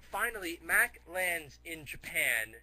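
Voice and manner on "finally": usual speaking voice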